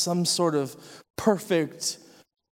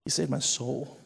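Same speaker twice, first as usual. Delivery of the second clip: hushed testimony; 5 dB quieter than usual. Real, not acted. Sharing his testimony with the congregation, hushed. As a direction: drop to a near hush.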